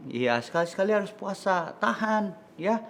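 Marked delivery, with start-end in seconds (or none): none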